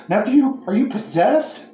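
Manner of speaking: high, frightened question; scared. A narrator's reading. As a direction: ask fearfully, voice jumping up. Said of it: "Legitimately scared".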